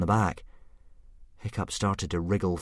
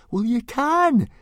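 put-on much higher, louder boy's voice; stoutly; 8 dB louder, much slower than usual. A narrator's reading. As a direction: insist firmly. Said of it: "said Fishlegs stoutly".

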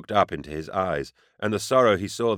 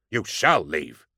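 barked; 2 semitones lower, slower than usual. A narrator's reading. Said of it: "Garrow barked".

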